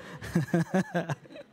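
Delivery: short real chuckle at own joke. Real, not acted. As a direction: chuckle after teasing yourself. Jokes about his four days alone.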